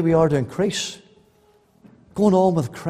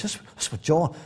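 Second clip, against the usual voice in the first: normal talking voice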